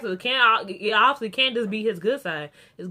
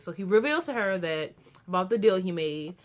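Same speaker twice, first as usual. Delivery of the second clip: normal talking voice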